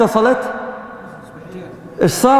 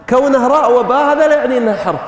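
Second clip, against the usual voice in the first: ordinary talk, nothing marked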